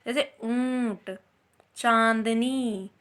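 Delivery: ऊंट and चांदनी stretched very slowly, nasal vowel held; very slow nasal demo words; drawn-out nasal vowels. Shown as a demonstration. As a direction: hum the ऊं and चां through the nose. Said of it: "नाक और गले द्वारा होता है".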